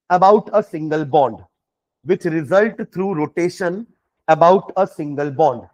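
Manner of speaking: very slow, measured dictation; word by word, pausing for writers; dictating. Real, not acted. Teacher dictates a definition for students to write, slowly and deliberately. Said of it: "चलो definition लिखना".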